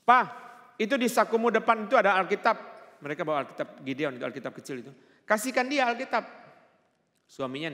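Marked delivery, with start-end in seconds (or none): 0.0-2.6 high, loud call imitating a wife; wife's shout. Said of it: "istrinya teriak dari dalam mobil". 5.2-6.3 high, loud call imitating a wife; wife's shout. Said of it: "istrinya teriak dari dalam mobil".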